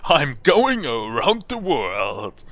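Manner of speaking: very slow, loud proclamation; grand boast. Acted. Traveller telling balloon seller of the voyage, grand boast. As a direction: draw every word out grandly.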